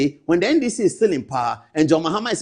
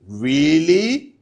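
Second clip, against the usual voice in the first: incredulous; 3 semitones higher, 6 dB louder than usual. Real, not acted. Host incredulous at caller's claim.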